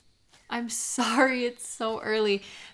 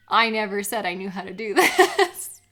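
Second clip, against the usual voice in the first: louder, laughing through the words; laughing protest; 7 dB louder than usual. Real, not acted. Friend teasingly defending herself to her best friend, laughing. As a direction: defend yourself mid-giggle.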